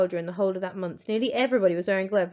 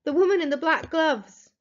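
slow, higher, louder shouted answer; fearful shout; 8 semitones higher, 5 dB louder than usual. Acted. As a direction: shout each word down, still scared. Boy shouts down from tree.

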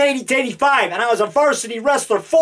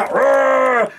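monster growl; 3 semitones higher than usual. Acted. Lobster monster attacking.

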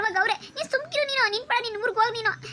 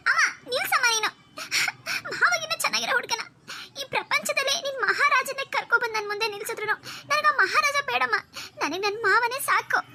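put-on higher, whiny young girl voice with a laugh; a spoiled girl refusing any other groom; pouting girl; 8 semitones higher than usual. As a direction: daughter brushing off mother's offer, whiny and high. High girl voice in one-person skit.

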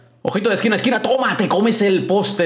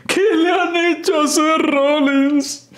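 drawn-out disbelief; 10 semitones higher, slower than usual. Real, not acted. Disbelief at Rollins' look.

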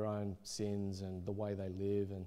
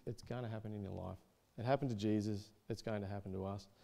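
ordinary delivery

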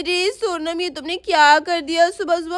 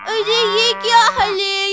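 normal talking voice

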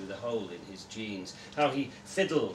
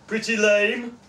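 higher teasing question; 8 semitones higher, 8 dB louder, slower than usual. Acted. Man asks the girl.

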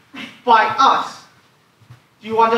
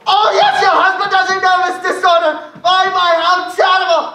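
exasperated; 6 semitones higher than usual. Acted. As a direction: shout her out the door. Exasperated banker loses patience.

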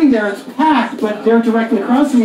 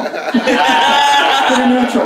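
very slow, stretched delivery; drawn out; much slower than usual. Shown as a demonstration. Comic repeating the punchline, very slow. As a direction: drag it out for the laugh. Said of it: "repeated line".

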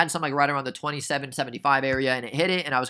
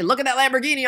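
excited showing off; 11 semitones higher, 4 dB louder than usual. Acted. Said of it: "I might not say".

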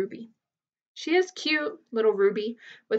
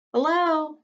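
higher, a lightly voiced young elephant; a little calf saying hello; small young voice; 6 semitones higher, 3 dB louder than usual. A narrator's reading. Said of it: "says a small, clear voice".

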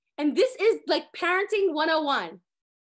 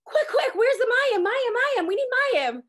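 much higher and louder, frantic rush for the water; flustered panic; 11 semitones higher, 7 dB louder than usual. Retold or imitated. Miriam and Aaron realizing Hashem came, flustered high panic. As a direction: hurry them like panicked people who just realized God is here. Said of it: "they're all flustered".

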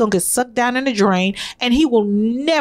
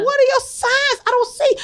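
baffled mom; 11 semitones higher than usual. Retold or imitated. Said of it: "And I said".

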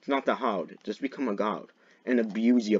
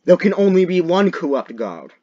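loud, high mock-epic declaration; grand proclamation; 7 semitones higher, 9 dB louder than usual. Guard challenging a corrupt rival, loud proclamation.

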